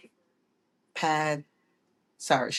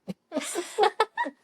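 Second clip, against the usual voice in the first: high laugh. Real, not acted. Laughing about son's torn pants.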